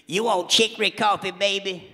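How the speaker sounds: lightly voiced Louisiana woman. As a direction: coo it like a Louisiana mama. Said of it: "The women".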